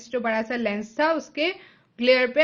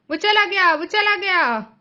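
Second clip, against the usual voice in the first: high, loud despairing cry; anguished scream; 7 semitones higher, 5 dB louder than usual. A narrator's reading. Mother screaming that her son has vanished, high and loud. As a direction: wail that he is gone. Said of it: "उन्होंने चिल्लाया".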